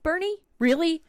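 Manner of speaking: high, pointed questioning of her son; skeptical questioning. Retold or imitated. Mother doubting her son's all-clear, high and incredulous.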